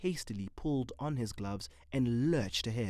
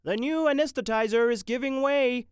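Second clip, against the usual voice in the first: high, loud alarmed shout; shouting intern; 12 semitones higher, 7 dB louder than usual. A narrator's reading. Intern shouting that the machine fails, loud. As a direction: shout the alarm across the room. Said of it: "shouted an intern".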